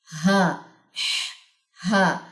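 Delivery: single हा then हा, each said apart with its own throat sound; isolated letter sounds. Shown as a demonstration. Teacher contrasting the two letters for students, crisp. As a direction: give हा and हा one by one, crisp. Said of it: "clear होने चाहिए दोनों के मखारिज".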